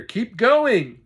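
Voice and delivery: high, loud command; dad shouting from beside him; urgent shout. Acted. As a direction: shout them onward.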